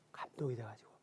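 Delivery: hushed, breathy whisper. Real, not acted. Tells the congregation the husband was moved, in a whisper. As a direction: drop to a whisper in awe.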